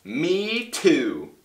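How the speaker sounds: high little voice; tiny joey. Acted. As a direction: chirp it like a baby in a pouch. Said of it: "young kangaroo in her pouch said".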